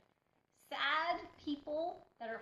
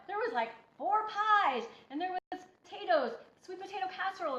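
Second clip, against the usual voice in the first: raised, louder listing of the feast; excited; 6 semitones higher, 5 dB louder than usual. Real, not acted. Telling her followers about the Friendsgiving spread, excited. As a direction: paint the tempting spread, voice up.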